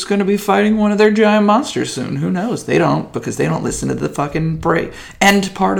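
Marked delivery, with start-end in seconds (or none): none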